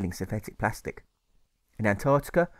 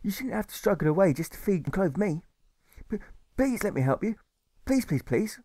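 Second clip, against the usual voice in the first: put-on pleading boy; 9 semitones higher than usual. Alfred pleads.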